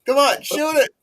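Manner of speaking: high, full-volume sports fan shout; imitated yelling. Hockey fan hollering at the players on TV, imitated yelling. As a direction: yell it over and over at the game on TV. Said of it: "he would always hear him yelling".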